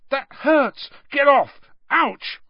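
high, loud yelps; sharp high pained outburst; pained protest. A narrator's reading. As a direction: cry out as if being poked. Poked painfully, protesting in the dream.

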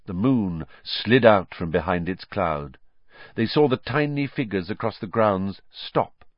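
plain reading voice

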